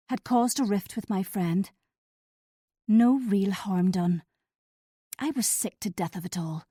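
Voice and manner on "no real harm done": "No real harm done" slow, a hint of menace; menacing echo. A narrator's reading. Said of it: "echoing with menace".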